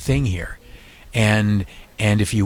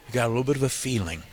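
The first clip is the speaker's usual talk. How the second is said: soft and quick; 2 semitones higher, 7 dB quieter, much faster than usual. Real, not acted. Intimate appeal continues.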